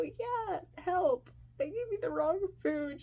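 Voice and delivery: high-pitched, helpless plea; pleading. Retold or imitated. Kid to the kind stranger, pleading. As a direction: beg for rescue in a small high voice.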